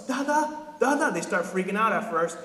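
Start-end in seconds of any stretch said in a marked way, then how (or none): 0.0-1.1 put-on high child's call for dad. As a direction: call out like a lost little kid. Kids calling for dad.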